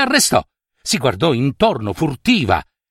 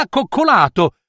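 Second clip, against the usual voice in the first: ordinary delivery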